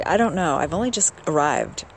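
ordinary talk

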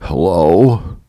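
sheepish answer on the phone; tentative, questioning pickup. Retold or imitated. Picking up a three a.m. phone call, sheepishly. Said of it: "I sheepishly said".

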